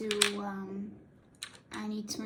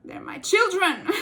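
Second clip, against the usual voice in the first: high, loud cooing mother voice, slow; doting mother; 11 semitones higher, 11 dB louder than usual. Retold or imitated. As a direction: coo it like a gushing mama. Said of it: "I'm like".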